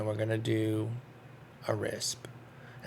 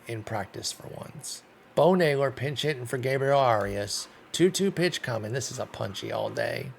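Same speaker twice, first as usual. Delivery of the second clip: ordinary talk, nothing marked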